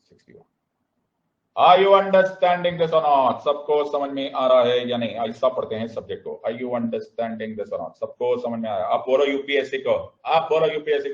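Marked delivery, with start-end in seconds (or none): 1.6-5.1 raised, drawn-out classroom challenge; emphatic check. Real, not acted. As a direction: press the whole class for an answer.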